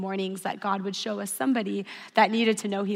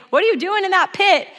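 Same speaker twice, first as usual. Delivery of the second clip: loud, high-pitched mock reproach; mock scolding; 8 semitones higher, 8 dB louder than usual. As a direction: scold in exaggerated disbelief, voice jumping up. Acts out scolding God would not give.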